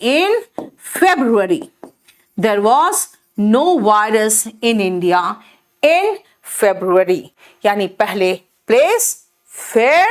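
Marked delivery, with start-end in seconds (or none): 0.0-1.7 slow teaching. Shown as a demonstration. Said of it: "teacher slow model sentence". 2.4-7.2 no virus in India said very slowly; slow model sentence. Shown as a demonstration. Teacher models the order for students, very slowly. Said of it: "teacher slow model sentence".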